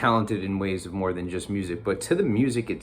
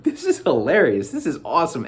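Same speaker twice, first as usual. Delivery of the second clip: higher-pitched retold delight; retold excitement; 5 semitones higher, 4 dB louder than usual. Recalling watching the show with friends, excited. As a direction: relive the thrill of discovering a show, higher.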